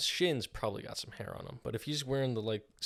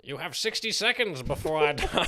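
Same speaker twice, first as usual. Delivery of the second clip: high loud villain; cartoon villain; 5 semitones higher, 7 dB louder than usual. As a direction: gloat through the countdown. Villain countdown.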